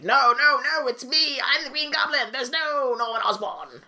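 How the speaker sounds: high, hammed-up Goblin protest; insistent villain. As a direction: cry out in mock desperation, high. Acts Goblin insisting on his identity.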